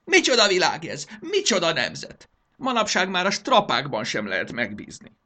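high, loud indignant raving; crazed ranting. Acted. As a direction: rave like a ruler losing his mind. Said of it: "mondán eszelősen felkacagott".

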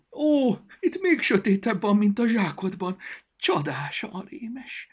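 high, loud thrilled squeak, slowing at the end; excited voice. A narrator's reading. Storybook thrilled inside the dark mailbox, high and excited. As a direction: marvel at the scary dark. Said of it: "izgatott hangot".

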